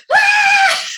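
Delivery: very high, very loud scream of delight; joyful scream. Retold or imitated. Singer hearing the band got number one, ecstatic scream. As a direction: let out a shriek of pure joy.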